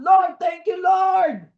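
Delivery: loud, high burst of thanks; joyful shout of thanks; shouted thanks to the Lord. Pretend. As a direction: cry out thanks to God at dawn. Morning prayer shouted in gratitude.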